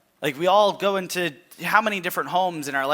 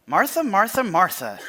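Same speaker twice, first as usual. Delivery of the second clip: higher, louder call of her name; calling out Martha; 5 semitones higher, 4 dB louder than usual. Acted. Jesus gently rebuking Martha.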